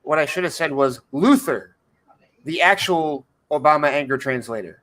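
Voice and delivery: ordinary delivery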